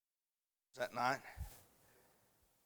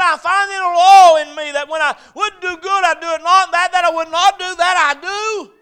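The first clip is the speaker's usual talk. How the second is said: high, sustained shouted confession; full-force preaching; 14 semitones higher, 22 dB louder than usual. Acted. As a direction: shout the scripture like a fired-up preacher.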